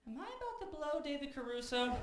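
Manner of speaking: high, squeaky disbelieving inner voice; incredulous thought. Retold or imitated. Comic asking himself in high shocked disbelief. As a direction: ask yourself in high-pitched shock. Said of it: "So I was like".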